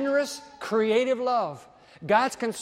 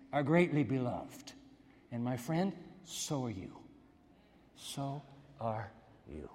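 softer, lower, tender assurance; 7 semitones lower, 10 dB quieter than usual. Shown as a demonstration. Preacher softly telling the congregation they are beloved. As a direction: hush down and tell them gently. Gabriel's message turned to listeners.

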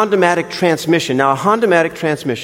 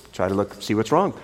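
usual speaking voice

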